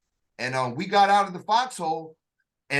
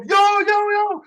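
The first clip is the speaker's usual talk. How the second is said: much higher, loud excited yo's; cheering crowd; 14 semitones higher, 6 dB louder than usual. Retold or imitated. Fellow recruits mobbing him after expert, cheering. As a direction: rush in hyped, celebrating. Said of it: "all y'all came up, came up".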